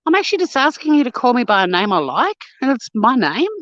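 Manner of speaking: high, fast, exasperated; fed up, set them straight; exasperated retort. Retold or imitated. Retorting to people renaming her.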